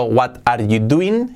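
"What are you doing?" read as spelled, Spanish-style; slow, badly pronounced "What are you doing?" as written; mispronounced English. Shown as a demonstration. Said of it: "mal pronunciado".

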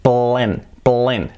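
"plen" as one syllable with short E; English syllable demo. Shown as a demonstration. Teacher isolating the second syllable, English pronunciation. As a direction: say "plen" alone, P running into L. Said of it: "y luego".

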